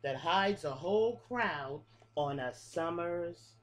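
lilting picture-book read-aloud, each line rising then falling; reading to children. Acted. Teacher reading a bug rhyme book to her class, sing-song. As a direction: read the rhyme like story time for little kids.

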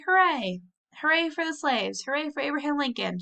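excited hoorays; shouted cheers. A narrator's reading. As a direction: cheer for Lincoln with all your heart. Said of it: "shouted Tom".

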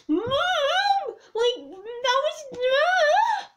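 very high, drawn-out screechy whine; demon kid tantrum. Retold or imitated. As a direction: imitate a demonic child screeching for mum. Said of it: "sounded like the demon version of a kid going".

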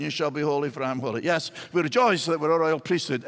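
normal talking voice

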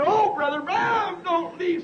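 high, slow pleading call; retold pleading cry. A dreamer retelling how she cried after the preacher, high pleading. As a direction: beg him not to go, voice lifted. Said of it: "I screaming".

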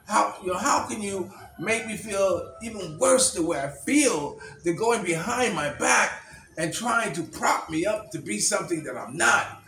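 high, loud retold anger at his wife; furious outburst. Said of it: "And he was furious".

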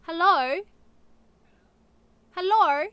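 calling hello across the house; loud calling out. Real, not acted. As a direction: call out loudly to someone in another room. Greeting someone entering room.

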